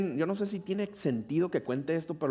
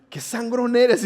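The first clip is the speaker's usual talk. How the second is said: mock annoyed girl; 9 semitones higher, 8 dB louder, slower than usual. Girl scolding narrator.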